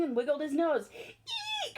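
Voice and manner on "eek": startled high eek; frightened eek. Acted. Elephant startled by the mouse, high frightened eek. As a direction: squeal in fright. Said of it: "shouted the elephant".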